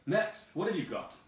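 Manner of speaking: head guard calling the next item. Acted. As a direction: bark for the next one shrilly. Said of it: "The head guard says".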